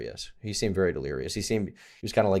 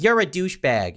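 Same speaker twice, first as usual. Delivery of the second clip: higher, lightly mocking woman's voice; imitated girlfriend insult; 7 semitones higher, 3 dB louder than usual. Girlfriend insults him at the bar, mock indignant. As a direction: mimic her accusation in a raised pitch.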